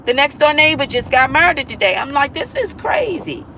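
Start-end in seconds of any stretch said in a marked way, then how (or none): 2.3-3.5 stunned disbelief. Retold or imitated. Hearing neighbor was murdered.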